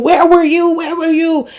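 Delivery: high, pleading demand, hammed-up; repeated, imitated angry question; mock angry yell. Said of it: "yelling at Ramon".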